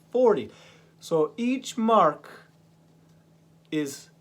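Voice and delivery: emphatic, slow teacher stressing each mark. Shown as a demonstration. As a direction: punch it home for the class. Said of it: "teacher emphasizing counting scale".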